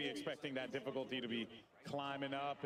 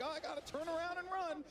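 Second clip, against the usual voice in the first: mock panic. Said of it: "before you're like".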